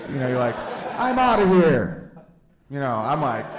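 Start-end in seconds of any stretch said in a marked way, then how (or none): 1.0-2.1 drawn-out, loud, high and falling; slow-closing door exit. Acted. Smartphone owner failing to slam the phone, slow drawn-out exit. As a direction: stretch it out like a door that won't slam. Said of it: "screen door with one of those things on it".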